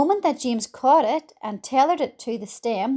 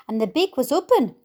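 plain reading voice

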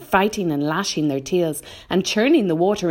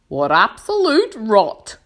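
loud, slow, higher-pitched dismissal; a little shaky; 7 semitones higher, 7 dB louder, slower than usual. A narrator's reading. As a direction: bluster slowly with a touch of unease. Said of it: "his voice seemed suddenly to have become a little shaky".

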